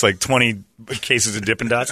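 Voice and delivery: normal talking voice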